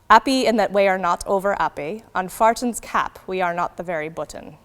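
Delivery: "Farton's" for fortune's, dropped h in "Appy"; original pronunciation. Shown as a demonstration. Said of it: "So fortune sounds more like farton in O P".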